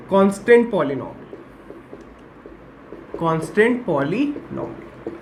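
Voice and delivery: very drawn-out syllables with a long pause; slow dictation. Real, not acted. Teacher dictating a new heading to students, slow dictation. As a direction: stretch each word as you write it.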